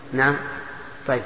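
ordinary delivery

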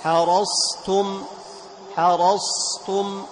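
حرصتم slow, ص kept full and heavy before ت; emphatic sad. Shown as a demonstration. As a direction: keep the ص of حرصتم from sliding to س. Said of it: "فلا بد أن تبين الصاد بإطباقها".

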